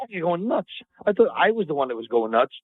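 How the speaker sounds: ordinary talk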